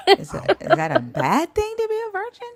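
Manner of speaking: high, teasing put-on innocence; high playful mock-naive question; mock innocent. Real, not acted. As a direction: feign wide-eyed surprise. Teasing question about being virgin.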